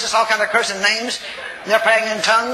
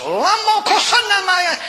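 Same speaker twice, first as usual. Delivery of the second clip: high mock wail in tongues, drawn out; imitating screamed tongues; 9 semitones higher, 3 dB louder, slower than usual. Preacher mimics forty people praying in tongues, high mock wail. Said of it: "screaming at the top of her voice".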